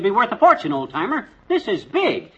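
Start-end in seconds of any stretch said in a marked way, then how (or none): 1.5-2.3 high-pitched "big", thrilled; excited. Acted. Husband thrilled at the uranium fortune, excited. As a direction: exclaim "This is big" at the find.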